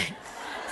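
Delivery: quiet breathy chuckle; soft laugh. Real, not acted. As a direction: chuckle under your breath. Laughing at own story.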